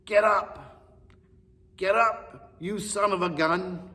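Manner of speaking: high, loud trainer's shout to get up; Mickey yelling. Retold or imitated. Trainer yelling at his fallen boxer, loud and high. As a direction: yell it like a boxing coach at ringside. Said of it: "But Mickey yells at Rock".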